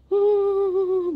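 sharp spooky huh; imitated eerie gasp. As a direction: mimic the sound from the cubicle. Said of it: "bunyi gitu".

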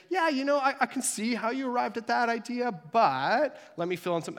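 mock-gentle diplomatic tone; gentle softening. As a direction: ease into a correction kindly. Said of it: "I try generally to soften the blow".

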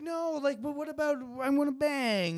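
high, pleading mock whine; high-pitched parody of a pouty superhero; mock whiny Wanda. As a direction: whine it like a lovesick girlfriend. Mocking Wanda's plea.

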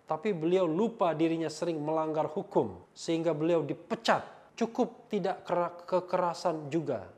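loud, declaimed read-out; emphatic comment reading. Acted. Reads critical comment aloud.

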